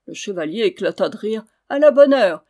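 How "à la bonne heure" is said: loud and much higher, bursting with mirth; laughing exclamation. Acted. Knight laughs at the boy's answer, loud exclamation. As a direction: exclaim it while still laughing. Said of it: "s'écria-t-il".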